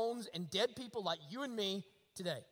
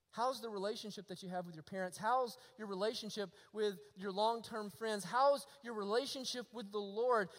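ordinary delivery

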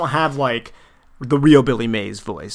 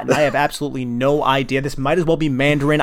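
ordinary delivery

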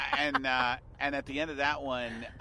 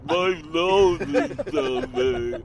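very slow, much louder groaning sick man; sick commercial moan; 9 dB louder, much slower than usual. Shown as a demonstration. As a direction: drag out every word like a miserable cold patient. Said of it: "you have to sit and go".

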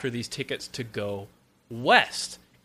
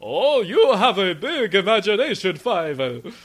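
put-on high, loud, indulgent old papa with a laugh; patronising father brushing off his son; Papa Mouskowitz voice; 6 semitones higher, 5 dB louder than usual. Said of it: "my Papa Mouskowitz voice".

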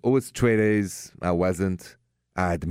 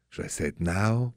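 usual speaking voice

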